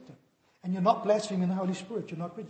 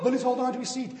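ordinary talk, nothing marked

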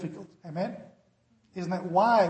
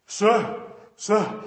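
panicked; 5 dB louder than usual. Retold or imitated. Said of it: "I panicked".

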